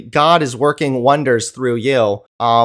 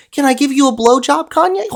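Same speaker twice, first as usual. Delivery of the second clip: much higher, cooing mockery; mock cooing offer; 10 semitones higher, faster than usual. [name] fawning over [name], voice way up high. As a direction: coo it up high like a fawning fan.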